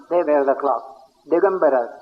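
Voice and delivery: normal talking voice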